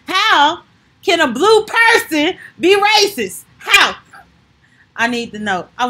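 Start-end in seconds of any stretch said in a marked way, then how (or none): 0.1-3.9 high, loud, slow disbelief; mock-outraged defense attorney; incredulous outburst.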